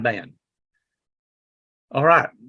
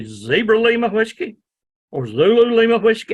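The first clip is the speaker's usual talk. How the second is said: higher, rising mock confusion; higher, louder puzzled query; puzzled questioning; 7 semitones higher, 3 dB louder than usual. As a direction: imitate the confused ham asking back. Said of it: "And they say".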